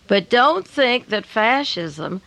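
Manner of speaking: slow, emphatic warning; deliberately slow. Real, not acted. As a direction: spell it out slowly for the listeners. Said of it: "I'll say this slowly for my listeners".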